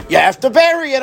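high, insistent mock fretting; worried study partner. Worried study partner correcting his friend, high and insistent. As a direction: imitate a fretting partner, pitched well up. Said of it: "My chavrusa is worried".

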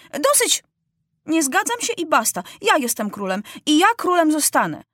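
high, insistent refusal; indignant high-pitched outburst; defiant boy king. A narrator's reading. King cuts off a minister, refusing.

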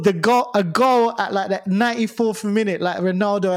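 loud excited storytelling. Real, not acted. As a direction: build it up like a goal. Excited retelling of celebration.

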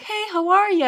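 high, loud, chirpy mock greeting; mock British greeting. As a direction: chirp it like a cheery Brit. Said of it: "British people are like".

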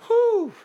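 high, whistled whew of dismay; stunned whew. Real, not acted. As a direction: let out a high whistling breath at sad news. Reacting to the child's death.

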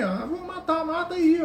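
high-pitched mock mob cry; retold crowd yell. Man recalls joining the crowd against Jesus, mock yelling. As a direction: imitate joining in a shouting mob. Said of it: "todo mundo gritava pra matar o cara".

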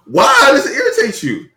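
high, loud incredulous comeback; indignant protest. Real, not acted. Protesting at being told to stop.

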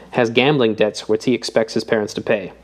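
usual speaking voice

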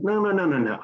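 high, rapid-fire protest at normal volume; retold losing it. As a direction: re-enact an urgent objection. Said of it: "that's when I lost it".

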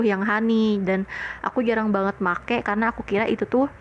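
ordinary talk, nothing marked

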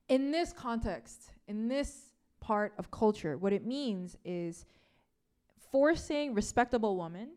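normal talking voice

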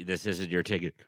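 ordinary talk

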